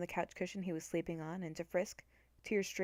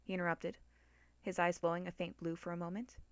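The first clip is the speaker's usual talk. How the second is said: ordinary delivery, nothing marked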